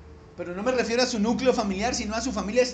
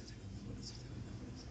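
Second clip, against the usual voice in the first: whispered, drilling the phrase; soft unvoiced repeat to yourself; whispered repetition; 19 dB quieter, faster than usual. Shown as a demonstration. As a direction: whisper it over like memorizing. Repeating softly to drill memory.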